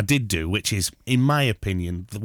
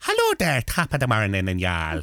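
bad Irish accent. Shown as a demonstration. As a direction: put on a poor stage-Irish accent. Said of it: "That's my Irish accent. I'm not very good".

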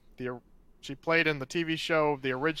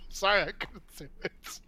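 laughing; 3 semitones higher than usual. Real, not acted. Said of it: "Couldn't even finish it without laughing".

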